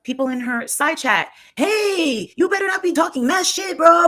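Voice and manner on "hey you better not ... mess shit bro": high-pitched mimicking rant; mock scolding. She mimics people in the side chat, mock scolding.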